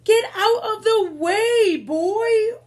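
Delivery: high loud royal command; ordered. Acted. King ordering Boy out of the fight, loud. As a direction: bark the order at the child. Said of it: "ordered the king".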